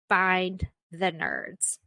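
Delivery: drawn-out, slow and deliberate; slow emphasis. Shown as a demonstration. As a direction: stretch out Find the nerds word by word.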